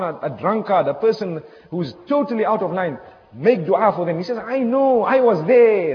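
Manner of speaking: rising, loud preacher's climax building to a cry; preaching at full force. Acted.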